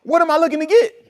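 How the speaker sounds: voicing a fed-up Israelite; mock complainer. Preacher mocking the complaining Israelites, whiny.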